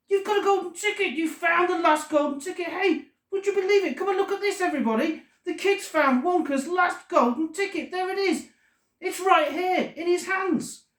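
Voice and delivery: very high-pitched, breathless-with-excitement shopkeeper; frantic excitement. A narrator's reading. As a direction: call everybody over, nearly having a fit. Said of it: "might be going to have a fit".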